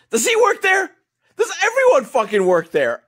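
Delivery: high, loud exasperated questioning; comic exasperated outburst; incredulous. Real, not acted. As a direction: demand an answer in disbelief. Incredulous rant about the plot.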